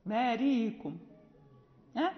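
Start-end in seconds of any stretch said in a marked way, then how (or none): 0.1-1.0 "بارئكم" as "baariikum", no glottal catch; softened hamza. Shown as a demonstration. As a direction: slide over the hamza in "بارئكم". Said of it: "يسهلها".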